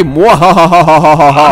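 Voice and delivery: guard gloating with a slow evil cackle. Acted. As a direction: drag out a hammy evil laugh. Villain guard laughs.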